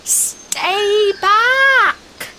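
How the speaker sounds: high, loud shouted warning. A narrator's reading. Sisters warn the third sister away, high loud shout. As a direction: shout it out to stop her. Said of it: "The other two shouted".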